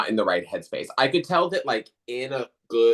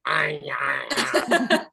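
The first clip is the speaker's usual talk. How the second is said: rising zombie groan, ending much higher; zombie noises; 4 semitones higher, much slower than usual. Shown as a demonstration. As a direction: moan like a hungry zombie. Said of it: "the zombie noises, Can you do that again?".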